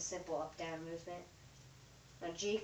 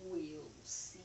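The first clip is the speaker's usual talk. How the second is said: whisper; 5 semitones lower, 6 dB quieter than usual. Real, not acted. Doubtful whisper after breaking toy.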